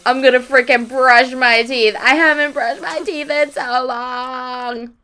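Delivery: loud, eager outburst; excited desperate Sim. Pretend. Sim finally gets to brush her teeth, loud and eager. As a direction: burst out like a survivor reaching a shower. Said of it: "she's like".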